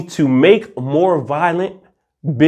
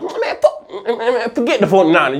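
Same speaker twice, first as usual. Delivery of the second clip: laughing fan; 7 semitones higher than usual. Acted.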